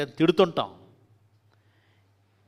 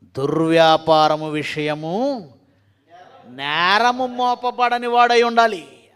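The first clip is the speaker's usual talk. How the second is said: loud preaching emphasis; 2 semitones higher, 9 dB louder, much slower than usual. Shown as a demonstration. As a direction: boom దుర్వ్యాపారము out loud and slow. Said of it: "ఆరో వచనం చదువుదాం".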